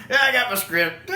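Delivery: mock singer with script. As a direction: play a singer who can't be bothered. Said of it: "they're sitting there holding the".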